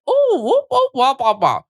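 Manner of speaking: high squeaky toy babble; Furby sounds. Retold or imitated. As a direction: chirp like a talking toy. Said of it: "Furby sounds".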